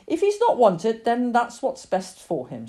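emphatic opening, slowing to a verdict; firm mother. Acted. Mother tells Lily what is best for the cat, firm. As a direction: give hard news firmly.

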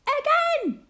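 sudden high, loud delighted cry; gleeful shout. A narrator's reading. Baby laughing at Bill's fall, shouting high and loud. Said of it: "the baby laughs and shouts".

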